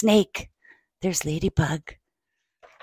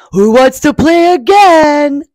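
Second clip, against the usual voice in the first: high, loud shout from Bear; excited shout; 6 semitones higher, 7 dB louder than usual. Acted. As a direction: shout an eager invitation to play. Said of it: "Bear shouts".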